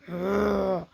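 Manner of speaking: low rolling lion growl; imitated roar for children. Shown as a demonstration. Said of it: "Roar like a lion".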